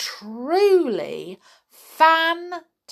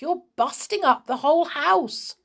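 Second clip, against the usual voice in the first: higher-pitched protest; mildly raised voice of objection; calling back; 6 semitones higher than usual. A narrator's reading. George objects to the wrecked house, protesting call. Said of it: "George called back".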